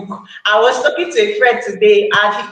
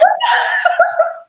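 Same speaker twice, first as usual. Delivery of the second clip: laughing. Real, not acted. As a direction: crack up at the memory. Amused reaction to easy book tip.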